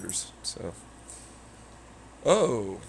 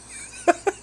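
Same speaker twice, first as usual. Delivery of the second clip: high giggle; 3 dB louder than usual. Real, not acted.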